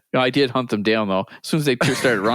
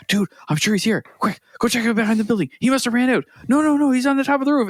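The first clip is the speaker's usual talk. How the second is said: higher, rising panic; hurried searching kids, climbing higher; frantic kid gamer; 10 semitones higher than usual. Retold or imitated. Kid urges squad to search roof, frantic and higher.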